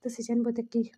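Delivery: plain reading voice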